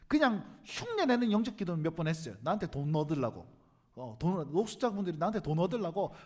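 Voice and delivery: ordinary talk, nothing marked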